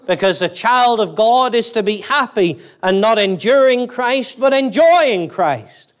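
rising, slowed, pressing on enjoying; mounting preaching emphasis. Real, not acted. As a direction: drive home enjoying Christ.

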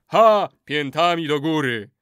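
loud, emphatic crowd echo; chorus response. A narrator's reading. Warriors echo the final curse, loud chant. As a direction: exclaim and chant it back.

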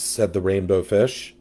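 plain reading voice

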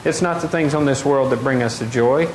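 normal talking voice

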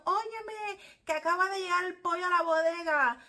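high, loud shout to the neighbor; imitating a neighbor yelling the news; neighbor call. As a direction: announce the news to the whole block. Calling neighbor about chicken arrival.

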